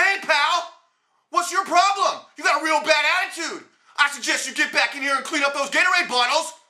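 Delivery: stern scolding of a punk, pitched high; retold confrontation. Clerk confronting a punk who knocked over bottles, stern scolding. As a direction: call him out like a store clerk. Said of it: "So I follow him out and say".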